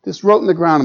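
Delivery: ordinary talk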